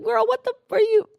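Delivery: put-on squeaky shocked "girl, what the"; high shocked call. Friend in back seat, shrieked up high in shock. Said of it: "and you're like".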